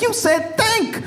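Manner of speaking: high, drawn-out comic outrage; incredulous retort. Acted. Woman catching the suitor's slip, hammed-up incredulous retort. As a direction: pounce on it, slow and very high-pitched. Woman pounces on his wording.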